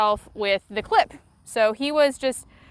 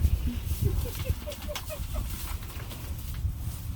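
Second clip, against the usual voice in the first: high breathy laugh; 8 dB quieter than usual. Real, not acted. Laughing after the game.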